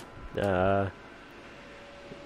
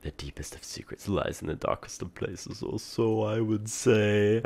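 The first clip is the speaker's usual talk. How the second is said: secretive riddling figure; 2 semitones higher than usual. Acted. Mysterious boy reveals where secrets lie, theatrical. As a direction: stretch the words like a mysterious storyteller. Voices game character dialogue.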